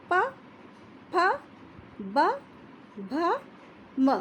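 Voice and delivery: प, फ, ब, भ, म each clipped apart; slow letter demo. Shown as a demonstration. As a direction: sound प फ ब भ म one at a time. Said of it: "आप बोलिए इनको". (होठों से धीरे-धीरे उच्चारण)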